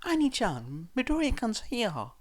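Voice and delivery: put-on girl's exclamation. A narrator's reading. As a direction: call to her brother, eager. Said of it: "the girl exclaimed".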